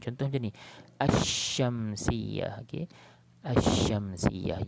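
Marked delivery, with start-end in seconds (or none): 1.0-2.7 "Asyamsiyah" very slow, A going straight into a long sh; lam merged into shin. Shown as a demonstration. Teacher modelling the example, "Asyamsiyah" with silent L and long sh. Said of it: "sebut A tu terus masuk dalam shin". 3.5-4.7 "Asyamsiyah" slow and loud with a long doubled sh; straight into shin. Shown as a demonstration. Teacher repeating for students, "Asyamsiyah" with no L, sh held. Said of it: "kita baca tu masuk terus dalam shin".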